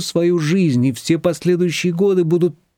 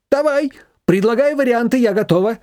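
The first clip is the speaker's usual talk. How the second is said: higher, expectant voice; eager mother-in-law; 10 semitones higher than usual. A narrator's reading. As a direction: wait eagerly for suggestions. Manana waits eagerly.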